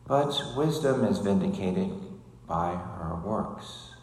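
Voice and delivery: loud, solemn gospel reading; gospel proclamation. Acted. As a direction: proclaim it to the whole church.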